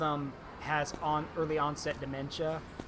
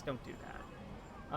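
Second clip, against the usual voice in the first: unvoiced, slow whisper; 4 semitones lower, 15 dB quieter, much slower than usual. Real, not acted. Streamer warning chat off cat talk, whispered. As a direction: breathe it out slowly as a warning.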